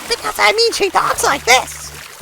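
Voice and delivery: very high-pitched game character voice; put-on sky-high character voice; Belle. Shown as a demonstration. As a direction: squeak it way up high, straining the throat. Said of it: "That would be Belle".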